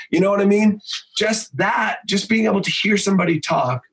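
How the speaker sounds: ordinary talk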